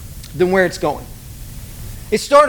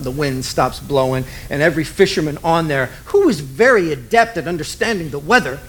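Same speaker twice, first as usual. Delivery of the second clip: ordinary talk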